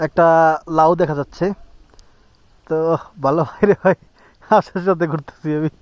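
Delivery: ordinary delivery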